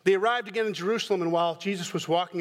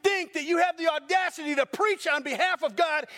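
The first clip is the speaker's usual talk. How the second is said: high, shouted outrage at the pulpit; furious shout at the preacher; angry intruder's shout; 9 semitones higher than usual. Pretend. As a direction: bellow it like you're swinging a sledgehammer. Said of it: "some guy comes in here with a sledgehammer and goes".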